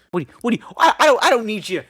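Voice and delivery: mild mock indignation; indignant ex-husband. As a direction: push back at the intruder. Acted ex-husband confronting new man.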